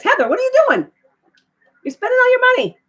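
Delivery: high, loud playful scolding; excited teasing. Real, not acted. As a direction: tease her, delighted and loud. Playful teasing of a generous viewer.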